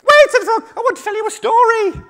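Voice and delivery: very high, loud little squeak; small animal voice. Acted. Frog to a hungry shark, high loud squeal. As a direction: squeal urgently in a tiny voice. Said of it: "all my small animals have got the same voice".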